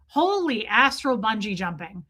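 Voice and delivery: ordinary talk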